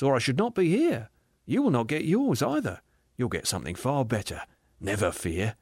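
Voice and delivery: gentle, lilting contradiction; reassuring spirit. Acted. Spirit comforting the aggrieved ghost, gently reassuring. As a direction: reassure lightly, easing down at the end.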